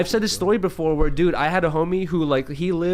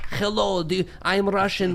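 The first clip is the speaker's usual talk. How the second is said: slow, hammed-up Russian accent; put-on Russian accent; 2 semitones higher, 3 dB quieter, slower than usual. Streamer showing how he'd convince people he's Russian, fake Russian accent. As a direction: imitate a heavy Russian accent, drawn out. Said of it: "like, a Russian accent".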